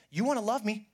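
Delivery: a firm divine order; God speaking. Retold or imitated. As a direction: proclaim it as God's command. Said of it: "And God says".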